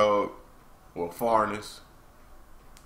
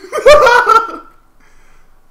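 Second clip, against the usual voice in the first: high cackling burst of laughter; loud laugh; 17 dB louder than usual. Real, not acted. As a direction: explode laughing, then fade. Laughs at own joke.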